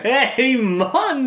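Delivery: normal talking voice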